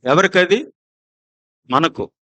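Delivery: normal talking voice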